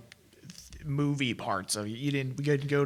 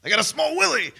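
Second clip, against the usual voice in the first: high, loud, hammed-up movie-star impression; not-great impression, pushed high and loud; bad Al Pacino; 12 semitones higher, 7 dB louder than usual. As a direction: belt it out as a rough caricature of the actor. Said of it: "I don't have a great Pacino".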